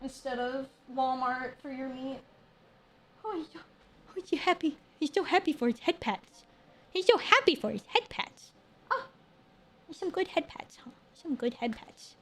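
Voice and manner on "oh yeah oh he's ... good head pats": high, soft praise for a happy dog; cooing pet talk. Real, not acted. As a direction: coo over the dog as you pat him. Praises the dog while petting.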